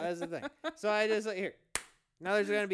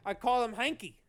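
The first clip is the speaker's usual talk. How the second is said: louder, higher Aussie punchline; Jim Jeffries; 4 semitones higher, 6 dB louder than usual. Acted. Host finishes co-host's borrowed joke, Australian accent. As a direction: land it as an Australian comic. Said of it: "just doing his bit".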